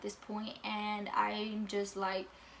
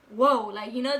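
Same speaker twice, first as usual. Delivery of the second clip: usual speaking voice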